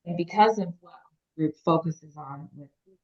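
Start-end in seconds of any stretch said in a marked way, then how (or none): 1.4-3.0 muffled by a hand over the mouth. Real, not acted. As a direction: speak through fingers over the lips. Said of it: "I might have put my hand over my mouth".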